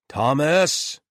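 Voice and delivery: loud yell through a door. Acted. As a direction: shout the name. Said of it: "yelled Galen".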